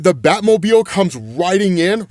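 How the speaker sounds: ordinary talk, nothing marked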